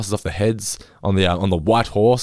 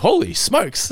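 cheery exclamation; 13 semitones higher, slower than usual. Shown as a demonstration. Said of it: "genuinely surprised".

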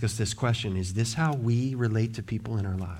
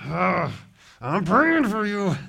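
a put-upon sigh of "Ugh" then a higher "I'm praying for you"; mock exasperated; 5 semitones higher than usual. Shown as a demonstration. Pastor mocking reluctant prayer for annoying people, exasperated. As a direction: roll your eyes through the promise. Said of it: "But it's not".

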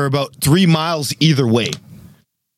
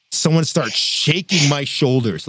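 ordinary talk, nothing marked

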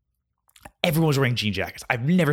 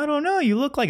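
much higher, airy mock girl voice; mock ditzy girl. Mocking a Boston girl guessing ages in a high voice. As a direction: pitch way up and play the clueless guesser.

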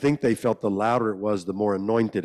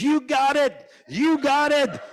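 much higher, thrilled lady voice; excited church lady; 3 dB louder, slower than usual. Retold or imitated. Old church lady cheering the boy at the altar, much higher. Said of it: "some lady goes".

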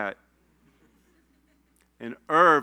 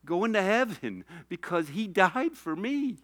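high, tearful testimony; pitched-up, choked-up emotion; really crying; 4 semitones higher than usual. Real, not acted. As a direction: talk through real tears. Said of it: "I'm crying talking about Irv".

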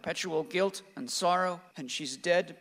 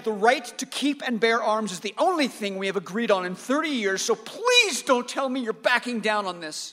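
loud, raised angry pleading; heated plea; 5 semitones higher, 7 dB louder than usual. Acted. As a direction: beg your father hard, raising the voice. Son pleads angrily with father.

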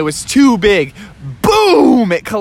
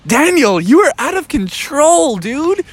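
scolding surfer dude; 8 semitones higher than usual. Acted. Surfers scold Daniel Tiger.

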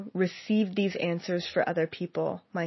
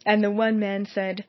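usual speaking voice

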